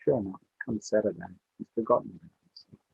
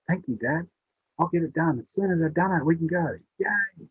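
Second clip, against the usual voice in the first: put-on eager child; 8 semitones higher than usual. Boy eager to finish puzzle.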